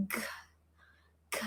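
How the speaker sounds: G as a bare "guh" burst; isolated hard G sounds. Shown as a demonstration. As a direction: sound out G alone, hard, with little voice. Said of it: "how do we pronounce G".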